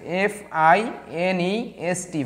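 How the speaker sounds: very slow, separated letters; spelling out. Shown as a demonstration. Teacher spelling finest for the class, slow spelling out.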